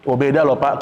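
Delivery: usual speaking voice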